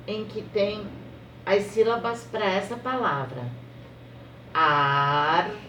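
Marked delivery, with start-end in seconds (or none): 4.5-5.6 Armário drawn out slowly, lower; slow syllable reading. Shown as a demonstration. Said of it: "desenho aí é de um armário".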